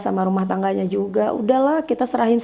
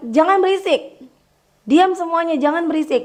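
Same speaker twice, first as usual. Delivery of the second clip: high, raised scold; scolding teacher; 8 semitones higher than usual. Retold or imitated. Teacher scolding a noisy class, high raised voice. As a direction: hush the class sharply, pitched up. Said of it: "Ketika aku bilang ke murid".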